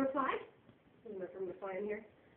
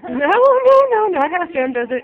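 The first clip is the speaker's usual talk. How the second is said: high loud no, no, no; urgent no; 4 semitones higher, 15 dB louder than usual. Real, not acted. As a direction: stop him fast, loud and high. Stopping toddler copying rough play.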